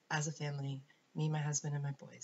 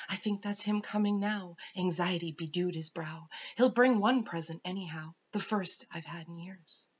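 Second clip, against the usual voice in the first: louder, dramatic storybook verse; poem recital; 2 semitones higher, 6 dB louder than usual. A narrator's reading. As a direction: recite with a touch of anxious anticipation. Reads poem, voicing anxious King John.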